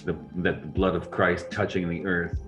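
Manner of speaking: normal talking voice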